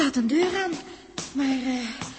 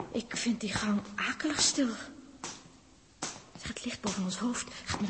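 whisper; 3 semitones lower, 7 dB quieter than usual. Acted. Uneasy in silent corridor.

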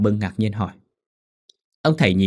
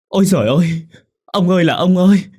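much higher, much louder wailing lament; 9 semitones higher, 8 dB louder than usual. A narrator's reading. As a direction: wail it out like a grieving mother. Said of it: "khóc lóc thảm thiết". (gào khóc thảm thiết)

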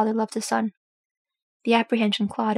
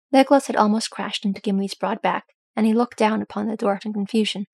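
plain reading voice

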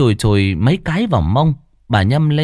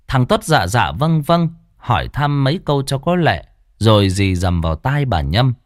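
ordinary delivery, nothing marked

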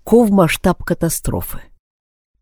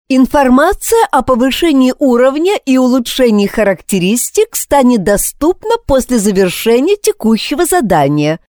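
higher, even assistant voice; 8 semitones higher than usual. A narrator's reading. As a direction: read it like a polite voice assistant. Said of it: "сообщила Алиса".

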